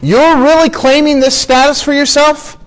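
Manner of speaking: high-pitched, disbelieving question; incredulous. Acted. Preacher voicing Pilate challenging Jesus's claim, incredulous. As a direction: act out Pilate's astonished doubt, pitched well up. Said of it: "hardly believing it".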